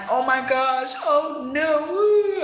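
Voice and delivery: high, rising mock freak-out; hammed-up shocked reaction; mock panic. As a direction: play up a dramatic gasping reaction. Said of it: "I'm not like".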